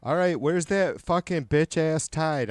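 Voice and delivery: ordinary delivery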